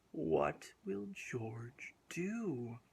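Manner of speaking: usual speaking voice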